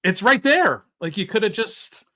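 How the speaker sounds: high, loud exasperated disbelief. Retold or imitated. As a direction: push it up, can't believe him. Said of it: "And I was like".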